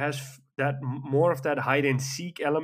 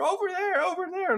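high, urgent mock shout; shrill-pitched callout mimicking a friend; retold teammate shouting. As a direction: yell it like a panicked teammate. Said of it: "the other one shouting in my ear".

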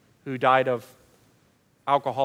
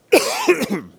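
loud hacking coughs; a sudden coughing fit; 11 semitones higher, 7 dB louder than usual. Real, not acted. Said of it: "sorry about the coughing, uh, fit".

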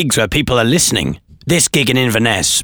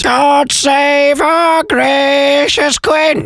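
very high, drawn-out parrot screech; parrot reciting; 3 dB louder, much slower than usual. Acted. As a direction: screech the rhyme like a parrot, slowly. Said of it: "So a law-breaking parrot there".

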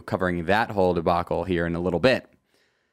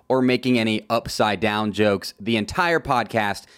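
ordinary talk, nothing marked

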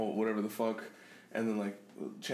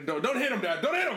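high, laughing urgent appeal; mock pleading; 9 semitones higher, 3 dB louder than usual. Son acting out restraining dad, mock pleading. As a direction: beg a parent to stop, half laughing.

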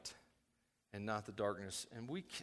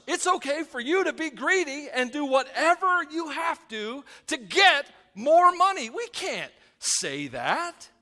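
loud, high, emphatic preaching; raised pulpit voice, hammering key words; forceful preaching; 13 semitones higher, 11 dB louder than usual. Real, not acted.

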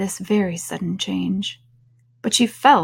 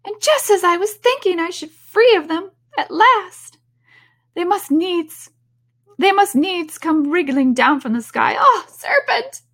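high, loud outraged squawking, peaking at the end; shrieking pigeon; 11 semitones higher, 5 dB louder than usual. A narrator's reading. Pigeon finding serpents even in the treetop, shrieking. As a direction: screech it in exasperation up to the final cry. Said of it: "raising its voice to a shriek".

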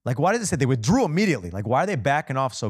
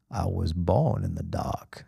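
slow, low, menacing villain voice; Bane voice; 5 semitones lower, 3 dB quieter, slower than usual. Retold or imitated. Preacher comparing Paul's line to Bane, slow and low. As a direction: growl it like Bane from the film. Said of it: "when Bane speaks in Dark Knight Rises".

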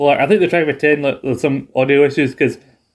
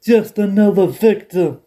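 higher, slow, gloating voice; mock Taz; 7 semitones higher, slower than usual. Taz boasting over choked J R, slow and gloating. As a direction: savor the line like a tough guy.

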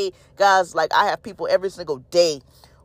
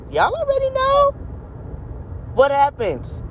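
squeaky high teasing. Acted. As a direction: pitch it way up, playful. Preacher teasing congregation.

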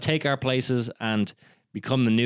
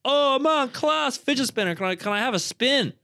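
imitating a higher, gushing stranger; eager admirer; 10 semitones higher than usual. Stranger begging a spin of the fidget spinner, eager mock voice. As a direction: gush like an impressed passer-by. Quotes an excited stranger asking.

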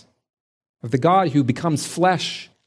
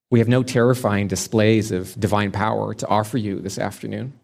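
ordinary talk, nothing marked

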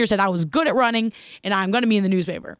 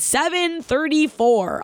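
slow, drawn-out time call from the teacher; slow announcement; 6 semitones higher, much slower than usual. Retold or imitated. Gym teacher calling out her mile time, slowly. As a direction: stretch each word like a stopwatch reading.

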